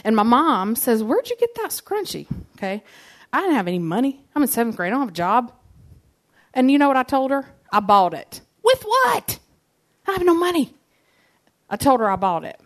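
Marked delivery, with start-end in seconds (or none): 1.0-2.3 higher, pointed mom question; mom asking. Retold or imitated. Mom questions her about scrunchie. 8.6-10.7 much higher put-on mom voice; doubting mom. Mom doubting she bought it.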